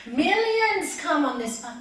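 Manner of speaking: slow, loud proclaim millions come; preaching loud. Real, not acted. Preacher quoting scripture to listeners, loud and slow. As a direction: call it out to the hall.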